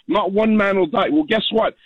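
ordinary talk, nothing marked

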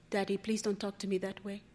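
very soft, hurried daughter's voice; subdued plea. Acted. Daughter to her daddy on a Skype screen, subdued. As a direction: ask it quietly, almost under your breath.

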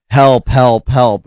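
mild mock cry for help; half-hearted imitation of someone yelling for help; retold scream. Host retells woman's cries at retreating man, mild mock scream. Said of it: "She's screaming".

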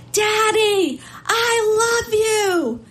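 high, drawn-out cry to Daddy; imitated child's scream. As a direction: scream it like a little girl, stretching the words. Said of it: "and she screams".

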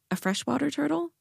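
high, steadily rising question; incredulous question. A narrator's reading. Jade questions her friend's choice of turtle, incredulous. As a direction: ask it in high-pitched disbelief.

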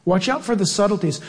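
usual speaking voice

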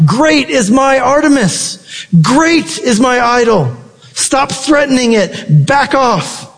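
high, drawn-out cry at normal volume; mock defensive shout. Preacher mimics defensive people guarding their idol, mock shout. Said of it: "You shout".

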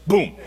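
sudden punchy "boom"; imitated pistol shot. Speaker mimics the starter's pistol alarm, abrupt boom. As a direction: fire off a starter's pistol vocally.

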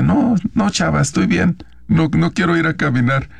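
anxious wife politely refusing to go for a walk; mother pleading. Retold or imitated. Mother refusing abusive husband's walk.

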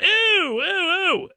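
high sing-song ooh, ooh, ooh; child's high mocking ooh; kid teasing ooh. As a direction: tease like a kid catching dad out. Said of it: "he started going".

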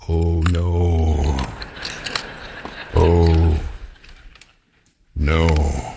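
very low, slow "Oh, no" of doom; deep dismayed groan. Acted. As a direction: moan it like a hooked fish realizing its fate. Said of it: "the bass thinks".

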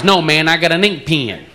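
insistent Texan, "pen" as "pee-in"; Texas accent. Retold or imitated. Austin guy clarifying to visitor, Texas accent. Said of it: "You talk about accent differences".

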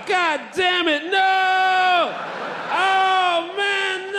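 high, drawn-out comic wail; mock despair. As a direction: wail as the hammed-up defeated guard. Acting out the thwarted guard's wail.

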